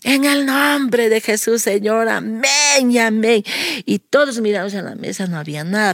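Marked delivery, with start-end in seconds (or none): 0.0-3.4 high, slow, drawn-out amen; imitated earnest prayer ending; acted fervent prayer. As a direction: close the prayer like a pleading child. Acting out the children's closing prayer.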